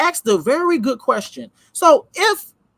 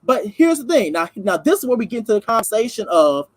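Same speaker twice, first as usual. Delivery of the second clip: usual speaking voice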